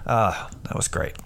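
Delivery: ordinary talk, nothing marked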